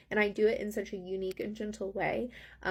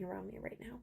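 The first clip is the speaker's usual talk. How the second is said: whisper; 14 dB quieter, faster than usual. Real, not acted. Overwhelmed by swirling energy.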